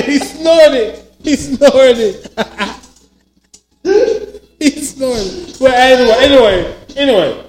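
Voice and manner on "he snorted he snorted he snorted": laughing. Real, not acted. Laughing at cohost's snort.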